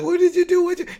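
high, loud shocked wife's cry; horrified cry. Retold or imitated. Wife horrified as Morgan shoots the baby, high shocked cry. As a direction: shriek it as the stunned mother. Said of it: "and she's like".

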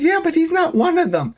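light falsetto imitation; Michonne's voice. Host voicing Michonne reassuring Natty, in falsetto.

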